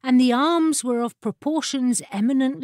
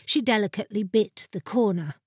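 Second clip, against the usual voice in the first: plain reading voice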